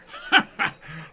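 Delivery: big loud burst of laughter, falling away; hearty laugh. Acted. Dwarf laughs at being called leader.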